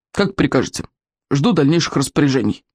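lightly voiced soldier reporting crisply; military report. A narrator's reading. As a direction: report like a soldier standing at attention. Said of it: "по-военному чётко отрапортовал". (по-военному чёткий рапорт)

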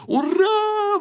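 very high, jubilant cheer; joyful exclamation. A narrator's reading. Photographer sees the camera rescued, jubilant. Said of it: "воскликнул объективчик".

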